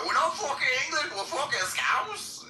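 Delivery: shrieked-up mock Liverpool accent, very high; loud, indignant Scouse impression; screaming Scouser. Imitating Scousers screaming in a bar, high Scouse yell. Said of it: "they kept screaming that in my face".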